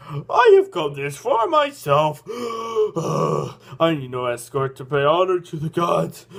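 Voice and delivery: put-on slow, loud creepy old man. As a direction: drag it out, creepy and proud. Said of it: "he's got a very creepy voice".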